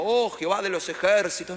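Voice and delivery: high, loud grandiose mock invocation; hammed-up solemn prayer voice; pompous prayer. Pastor mocking an overly formal prayer to God, pompous.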